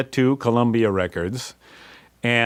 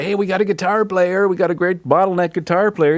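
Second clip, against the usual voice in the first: much higher, eager sell; eager pitch; 11 semitones higher, 5 dB louder than usual. Retold or imitated. Band boasting about their guitarist to the exec, higher and eager. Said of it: "and we say".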